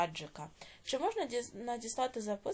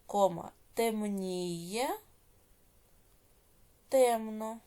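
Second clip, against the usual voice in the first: slow, drawn-out syllables with rising ends; dictation; much slower than usual. Shown as a demonstration. Teacher dictating exercise eight to pupils, slow measured dictation. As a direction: dictate each word slowly, leaving long writing pauses.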